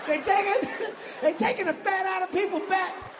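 high, laughing through the words. Real, not acted. Comic laughing at his own joke, high laughing delivery. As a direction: crack up as you repeat it. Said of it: "laughing at his own line".

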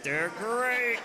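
mock Tony the Tiger boom; lightly voiced cereal tiger. Comic doing Tony the Tiger for the crowd, mock boom. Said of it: "He's like".

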